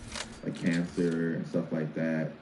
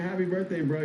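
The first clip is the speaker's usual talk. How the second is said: retold greeting; 11 semitones higher than usual. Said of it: "I was like".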